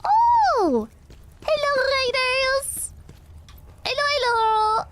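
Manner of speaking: high-pitched, squealed welcome; excited greeting. Real, not acted. Streamer welcoming a raid, excited high squeal. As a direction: sing out hellos way up high, stretching them.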